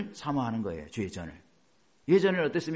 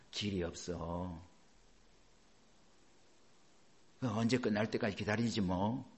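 resigned sigh; 6 semitones lower, 6 dB quieter, slower than usual. Retold or imitated. Resigned believers voiced.

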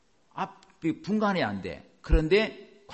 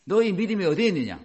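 challenging question; 6 semitones higher, 7 dB louder, faster than usual. Acted. Jesus challenges the disciples.

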